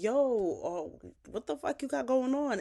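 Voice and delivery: half yell it, imitating an angry father; retold yelling. Father confronting mother over son in the bathroom, mild yell. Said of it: "Zach was yelling".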